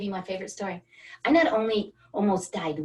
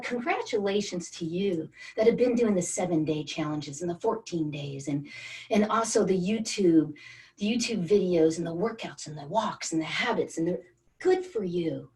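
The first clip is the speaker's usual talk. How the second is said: normal talking voice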